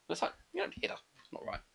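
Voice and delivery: whispering. Real, not acted. Whispered aside.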